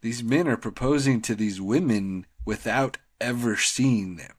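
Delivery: ordinary delivery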